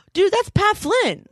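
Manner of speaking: high, slow, amazed whisper-like aside; as if spotting a celebrity; surprised recognition. Retold or imitated. She spots Pat Flynn at baggage, amazed. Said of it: "I'm like".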